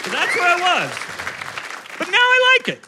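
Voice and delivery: high, loud excited reveal; gleeful high-pitched announcement; excited squeal. Real, not acted. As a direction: burst up into delight. Excited turnaround.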